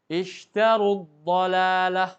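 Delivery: very slow, loud "ishtaru dhalalah", waw dropped, u running straight into d; waw merged away. Shown as a demonstration. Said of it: "seakan-akan tidak ada panjang lagi wawunya".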